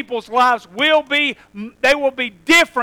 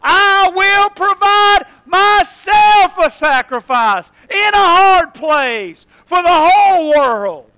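high, loud, slow proclamation; God's vow; 4 semitones higher, 4 dB louder, slower than usual. Acted. Preacher voicing God's vow, loud and slow. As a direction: ring it out as God's own promise. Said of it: "God reminded Himself".

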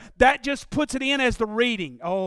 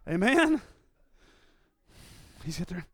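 usual speaking voice

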